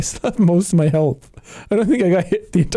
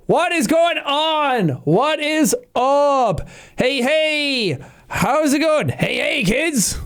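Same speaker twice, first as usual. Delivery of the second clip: high, drawn-out clown-host greeting, much higher; Krusty the Clown intro; 7 semitones higher, much slower than usual. Retold or imitated. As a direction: stretch it out like a cheesy kids' show clown. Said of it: "Krusty the Clown ass intro".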